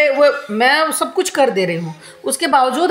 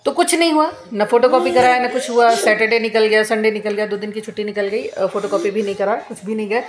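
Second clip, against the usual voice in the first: usual speaking voice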